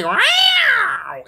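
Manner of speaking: long, high yowl; imitated cat yowl; screeching cat. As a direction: wail like a cat sent flying. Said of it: "my poor little cat say".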